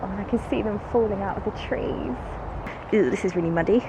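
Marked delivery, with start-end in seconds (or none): none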